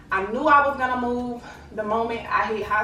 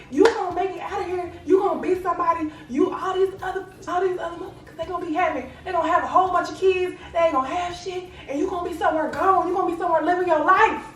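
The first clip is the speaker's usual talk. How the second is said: higher, fast, urgent mama voice; raised tough-love mom, pushing fast; imitating her mama; 7 semitones higher, faster than usual. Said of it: "She was like".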